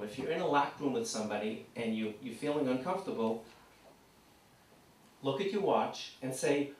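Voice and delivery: usual speaking voice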